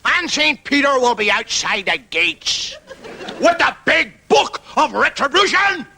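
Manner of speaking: high booming preacher voice; Paisley preaching. Retold or imitated. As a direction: keep roaring it from the pulpit. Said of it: "and he talks like that".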